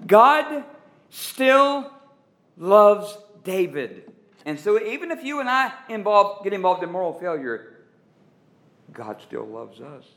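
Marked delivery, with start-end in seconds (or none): 0.1-4.1 each word spaced and punched, slowing on loves David; emphatic slow declaration. Acted. Preacher to congregation, each word spaced and stressed. 8.9-10.0 dropped much softer and lower, almost confiding; hushed emphasis. Acted. Preacher assuring listeners of grace, hushed and low.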